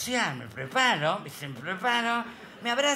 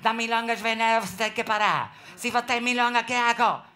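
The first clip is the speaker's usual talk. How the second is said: put-on loud Brazilian-accented Spanish preaching; Brazilian Portuguese accent; 4 semitones higher, 5 dB louder than usual. Comic imitating the Brazilian pastor's sermon, loud Brazilian accent. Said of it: "decían todos los drogadictos".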